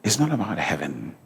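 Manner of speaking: low, soft aside; hushed earnestness. Real, not acted. Preacher explaining to congregation why he quit drinking, hushed earnestness.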